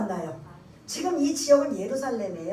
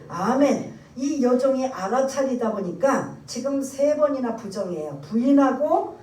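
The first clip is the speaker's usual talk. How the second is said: usual speaking voice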